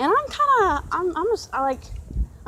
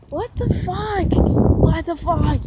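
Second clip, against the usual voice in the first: slow, stretched-out what the fuck, louder on the second; drawn-out disbelief; 4 semitones higher, 5 dB louder, much slower than usual. Real, not acted. Complaining to viewers about his manager, slow exasperated drawl. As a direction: drag each word out in disgust.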